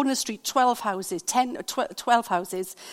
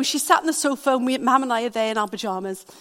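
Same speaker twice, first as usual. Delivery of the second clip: usual speaking voice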